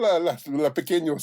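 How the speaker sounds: high Mexican Missus Doubtfire voice; playful mock Mexican cactus lady; imitating Layla. Host prompting his partner's cactus character, high mock voice. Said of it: "a Mexican Missus Doubtfire".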